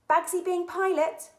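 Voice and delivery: high, loud, bouncy call; a kid shouting dibs; gleeful claim. A narrator's reading. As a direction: grab the pilot seat first. Eager girl claiming pilot seat.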